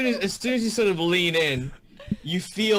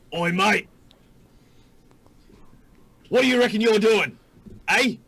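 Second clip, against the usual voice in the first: high, loud brash Australian bloke; rowdy Australian hobgoblin picking a fight; Australian accent; 7 semitones higher, 5 dB louder than usual. Acted. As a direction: square up like a cocky Australian hobgoblin. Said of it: "the Hobgoblins in my world are Australian".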